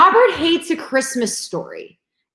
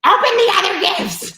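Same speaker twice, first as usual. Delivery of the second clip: mock yell; 8 semitones higher than usual. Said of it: "everyone's yelling at them".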